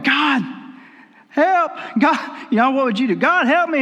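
high, pleading cry to God; retold desperate prayer, pitched high; desperate cry for help. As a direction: beg God for help from the cliff. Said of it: "he's crying out to the Lord".

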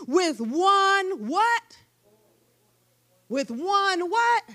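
drawn-out question, pitch rising; preacher inviting the answer; slow call and response. Acted. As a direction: stretch it out, waiting for them to answer.

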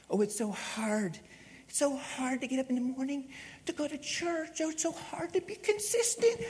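high, soft mock-crying whine; whiny excuse maker. Pastor mocking lazy churchgoers, high whiny mock crying. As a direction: whimper the excuses like a spoiled child.

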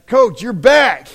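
delighted loud welcome; excited greeting. Retold or imitated. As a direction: call it out like a team welcoming him back. Players greeting returning coach.

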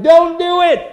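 very loud, high shout at the screen; acted warning yell. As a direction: yell like a frantic movie watcher. Moviegoer yelling at the screen.

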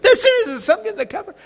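put-on very high, fast, frightened child pleading; scared little girl. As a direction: rush it out like a terrified small girl. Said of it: "I said".

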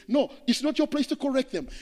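ordinary delivery

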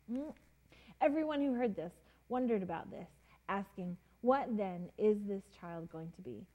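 soft, unhurried Bible reading; storyteller reading scripture. Acted. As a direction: read the passage gently to the kids. Reading scripture aloud to children.

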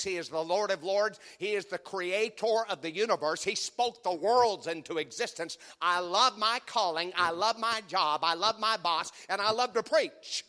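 rousing preacher delivery; preaching. Acted. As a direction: declare it to the congregation. Pulpit opening, lord and calling.

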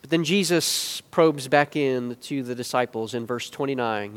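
ordinary talk, nothing marked

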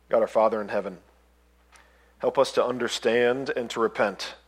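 soft, hushed closing prayer. Real, not acted. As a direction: pray it quietly and humbly.

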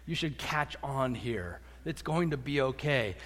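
low, weary talking-down; mildly condescending. Acted. Jesus to Peter who should catch on, mildly condescending. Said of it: "it sounds kind of condescending".

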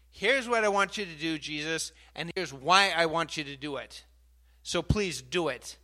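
loud, pushy prayer to Jesus; demanding prayer. Retold or imitated. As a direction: tell God what to do. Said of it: "We tend to tell Jesus what to do".

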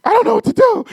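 high, loud, panicky squeal; a comic, frantic cry of not knowing what to do; panicked. Retold or imitated. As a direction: sound lost and scared mid-scuffle. Panicked self-quote on top of fighter.

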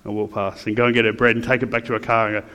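ordinary talk, nothing marked

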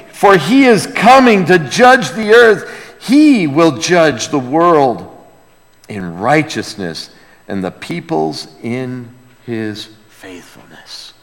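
ordinary talk

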